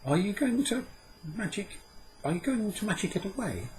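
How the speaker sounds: put-on high boy's voice, anxious and quick; a boy drawing back, worried; wary boy. A narrator's reading. Dan drawing back from Puck, high anxious boy's voice. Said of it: "said Dan, drawing back".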